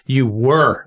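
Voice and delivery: slow "you were" with "were" hit and falling; correcting the emphasis, slowly; stress on were. Shown as a demonstration. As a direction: drag it out and land hard on "were". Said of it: "That's where the emphasis is".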